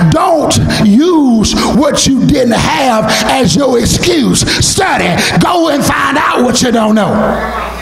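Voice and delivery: usual speaking voice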